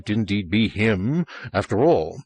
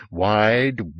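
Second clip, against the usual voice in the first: ordinary delivery, nothing marked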